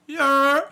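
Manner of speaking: sudden high, loud yelp. Pretend. Riffing on New York with friends, yelped high. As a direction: let out a piercing whoop. Whooped noise amid accent riffing.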